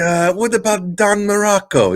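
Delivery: high, hammed-up accented questioning; badly faked wrestler accent, hopefully prying; Iron Sheik. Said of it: "your Iron Sheik is awful".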